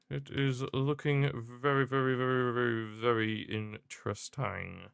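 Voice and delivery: soft, slow, drawn-out ominous tone; suspenseful hush. Real, not acted. Streamer teases viewers about the final, hushed and slow. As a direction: sink into it slowly, teasing.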